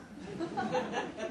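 a bright high laugh escaping; high giggle. Real, not acted. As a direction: giggle at the grim joke. Reader laughs at paperclip detail.

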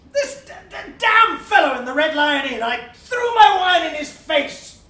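loud, high and furious; angry outburst. Acted. Sir John Paternoster raging to the gentlemen about the fellow at the Red Lion, loud. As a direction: spit the memory out in rage.

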